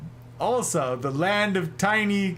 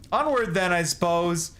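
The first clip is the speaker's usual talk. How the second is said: ordinary talk, nothing marked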